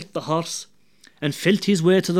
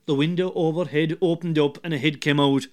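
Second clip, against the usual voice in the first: ordinary delivery, nothing marked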